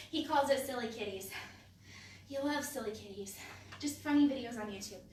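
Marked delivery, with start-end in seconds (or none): none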